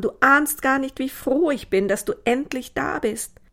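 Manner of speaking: joyful relief. A narrator's reading. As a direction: gush with joy at a long-awaited arrival. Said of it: "mit freudig blitzenden Augen". (freudig aufgeregt, mit hellerer Stimme)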